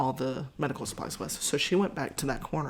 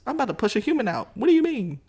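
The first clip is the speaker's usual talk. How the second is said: much higher, louder and fast, half panicked; exasperated; 7 semitones higher, 8 dB louder, faster than usual. Retold or imitated. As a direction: rush it out in disbelief. Exasperated at the doctor's joke.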